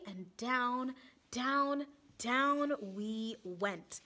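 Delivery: repeated down, last drawn out slow and breathy; storyteller's suspense; dramatic build. A narrator's reading. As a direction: sink the words down slowly. Narrator dramatizes the descent.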